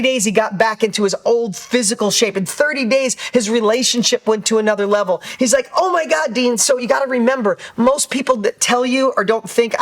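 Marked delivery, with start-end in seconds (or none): 5.7-6.6 amazed exclaim. Retold or imitated. Buddy amazed by his change.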